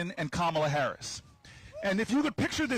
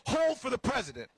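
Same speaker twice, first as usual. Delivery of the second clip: lightly voiced brisk operator; mock operator; 3 semitones higher than usual. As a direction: clip it like a busy switchboard. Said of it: "they should just say".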